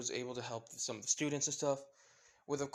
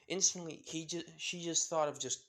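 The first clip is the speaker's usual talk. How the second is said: ordinary delivery, nothing marked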